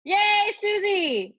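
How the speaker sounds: high, slow cheer; excited cheer. Real, not acted. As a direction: cheer her name out long. Cheering the winner.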